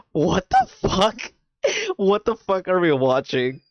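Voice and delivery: stare in disbelief, then laugh; mock disbelief. Imitating her shocked reaction to the cheering crowd.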